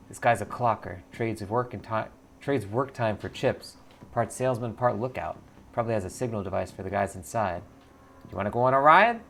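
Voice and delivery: usual speaking voice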